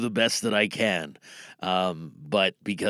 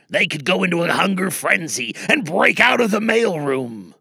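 lively, louder character voices bickering; radio drama characters; 8 semitones higher, 6 dB louder than usual. Acted. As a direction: play a bus crew stranded without gas. Performs voiced radio drama script.

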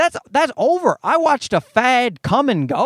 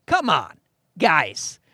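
usual speaking voice

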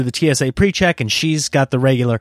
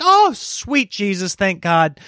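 high, drawn-out thankful exclamation; huge relief; 7 semitones higher, slower than usual. Retold or imitated. Traveler told his bag is fine, hammed-up relief. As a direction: let relief pour out, starting high and slow.

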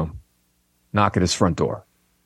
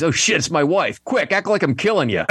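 higher, mock-panicked lion caught out; 7 semitones higher than usual. Lion caught cheating hisses to zebra, mock panic. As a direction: blurt it urgently, as the cheating lion.

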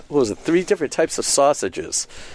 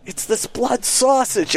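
friend explaining; 9 semitones higher, slower than usual. Retold or imitated. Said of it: "And he's going".

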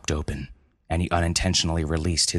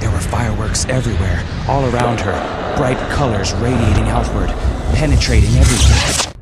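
louder, faster, rising to a roar; building excitement; 4 semitones higher, 6 dB louder than usual. A narrator's reading. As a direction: build it up like the blast itself. Climactic explosion narration.

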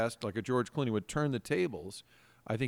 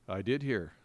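flat, cool reply; 4 semitones lower, 6 dB quieter than usual. Retold or imitated. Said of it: "and he's like".

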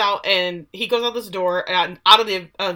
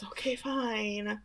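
very soft, slow concession; reluctant giving in; 12 dB quieter, much slower than usual. Retold or imitated. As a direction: relent under the breath, drawn out. Gives in to doe eyes.

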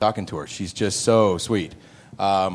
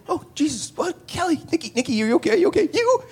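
much higher, frantic babbling; panicked high voice. Retold or imitated. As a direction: fluster in shock after the punch. Panicked exchange after hit.

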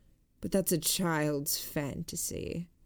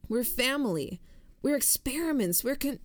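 higher-pitched insistence, broken off; urgent appeal; 8 semitones higher than usual. A narrator's reading. Narrator appealing to a hostile woman as family, urgent and high. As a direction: press the point in a raised pitch until cut short. Pleading appeal gets cut off.

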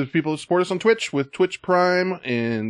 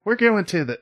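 higher, leprechaun-ish Irish try; a half-hearted Irish lilt; mock Irish accent; 10 semitones higher than usual. Host attempting an Irish accent for the intros, mock Irish. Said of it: "like a leprechaun".

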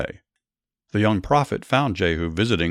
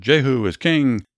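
higher, louder cheer of acclaim; shouted proclamation; 6 semitones higher, 4 dB louder than usual. Acted. Commanders hailing Jehu as their new king, shouting. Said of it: "They blew a ram's horn and shouted".

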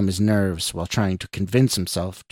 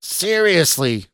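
slow, high, exasperated growl; 8 semitones higher, 4 dB louder, slower than usual. A narrator's reading. Stranger fed up with Scott's boss, growling. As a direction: drag it out in angry disbelief. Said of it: "the stranger growled".

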